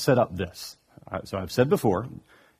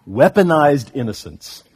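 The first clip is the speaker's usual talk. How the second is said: usual speaking voice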